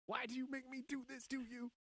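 high, put-on voice of a wife-beater; mock abuser voice. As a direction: whine it like a self-pitying abuser. Voicing [name] abusive line.

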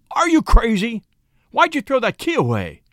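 high, loud incredulous outburst; acted exasperated anger; angry blow-up. A narrator's reading. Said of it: "Henry blew up".

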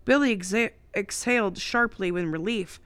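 usual speaking voice

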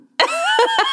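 high squealing laugh. Real, not acted. Laughing at the story.

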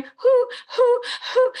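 high hooting sobs, imitated; owl-like crying. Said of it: "She sounds like an owl".

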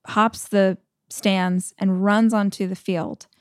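normal talking voice

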